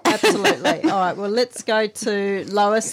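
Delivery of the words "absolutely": laughing through the word. Real, not acted. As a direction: breaks into a laugh. Laughing at co-host's joke.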